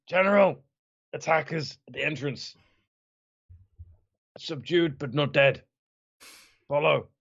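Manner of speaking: lightly acted wounded messenger; a hint of pain while calling out; pained, injured voice. Player reporting to the general as if injured, mildly pained. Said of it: "make it sound pained, like I've been injured".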